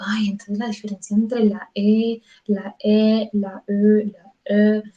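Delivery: "la E" dicha varias veces, cada vez con una vocal E distinta; experimenting with vowels. Shown as a demonstration. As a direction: prueba matices distintos de la E francesa. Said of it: "va como experimentando". (probando distintas vocales E)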